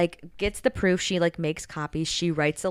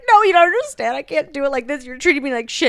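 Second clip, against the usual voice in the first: upset protest; 9 semitones higher, 8 dB louder than usual. Acted. Sailor upset, refusing Hunter.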